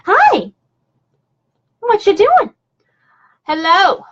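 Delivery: high, cooing pet greeting; puppy talk. Real, not acted. Owner greeting her puppy, high pet-talk.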